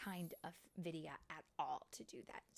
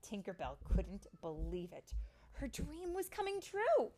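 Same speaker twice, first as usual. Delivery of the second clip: building, wonder-filled storybook reading; high, sweeping storytime narration; story narration for children; 4 semitones higher, 7 dB louder, slower than usual. A narrator's reading. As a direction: rise with excitement, drawing out phrases.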